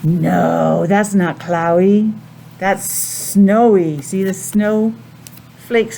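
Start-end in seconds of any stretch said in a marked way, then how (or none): 0.0-4.0 put-on slow, lower model line for kids to repeat; teacher modeling the answer for children. Shown as a demonstration. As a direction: say it slowly so children can copy.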